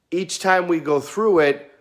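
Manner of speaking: ordinary talk, nothing marked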